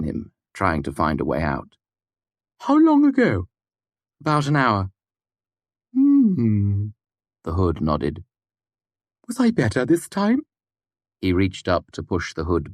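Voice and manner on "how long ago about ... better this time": put-on high, muffled odd old man; an oddball asking from behind a door; eccentric old man. A narrator's reading. Puppet voiced in dialogue.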